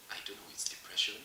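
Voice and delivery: whispered hesitantly. Real, not acted. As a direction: drop to a whisper on the word. Hesitant about naming depression.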